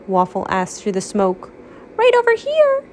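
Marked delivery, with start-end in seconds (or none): 2.0-2.8 much higher squeaky falsetto. Acted. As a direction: squeak it in falsetto like a tiny child. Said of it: "a falsetto voice spoke".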